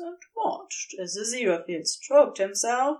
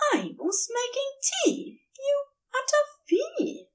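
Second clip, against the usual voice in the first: higher, playful mock scolding; amused scolding. A narrator's reading. Aziraphale fondly scolds Crowley for keeping him in bed, amused. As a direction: scold fondly, not really cross. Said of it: "sounding more amused than annoyed".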